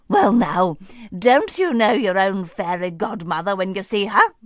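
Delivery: put-on jolly old lady voice, delighted; beaming fairy godmother. Fairy godmother greets startled Cinderella, jolly and beaming. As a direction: greet her like a cheerful granny. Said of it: "she beamed".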